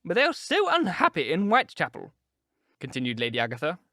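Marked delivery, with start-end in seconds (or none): 0.0-2.1 fussy aunt. A narrator's reading. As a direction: scold the men like a flustered aunt. Lady Agatha voice again.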